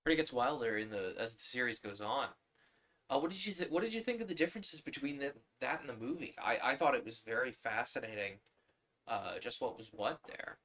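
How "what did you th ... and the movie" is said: ordinary delivery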